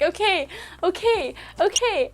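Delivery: high, flustered okays; freaking out. Retold or imitated. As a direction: squeal the okays as if overwhelmed. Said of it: "freaking out".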